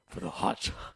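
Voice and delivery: low, husky Batman rasp. Retold or imitated. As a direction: rasp the answer like a grim hero. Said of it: "we cannot take you seriously".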